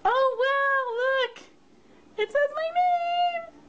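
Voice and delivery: very high, thrilled squeal; delighted squeal. Real, not acted. Crafter finding her name on the box, delighted squeal.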